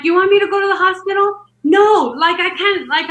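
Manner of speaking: high, indignant refusal; exasperated outburst at an absurd suggestion; retold yell at husband. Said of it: "I yelled at him".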